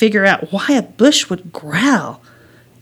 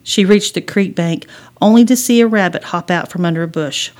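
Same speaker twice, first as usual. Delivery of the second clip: usual speaking voice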